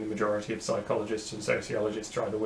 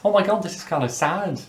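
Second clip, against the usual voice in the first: put-on a woman's Doncaster accent, a little higher; retry the Doncaster girl's goodbye, more committed; Doncaster accent, take two; 7 semitones higher, 4 dB louder than usual. Second take of her goodbye at the tram, Doncaster accent. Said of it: "Try again. Take two".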